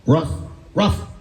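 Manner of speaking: high doggy woofs as words; talking dog. Acted. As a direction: answer in a short comic bark. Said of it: "And the dog says".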